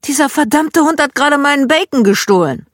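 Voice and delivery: hammed-up fury at the dog; furious complaint. Dad ranting at the dog that stole his bacon, mock angry shout.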